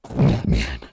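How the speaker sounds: low mock Batman growl. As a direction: drop the voice low like the Caped Crusader. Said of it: "Who are you?".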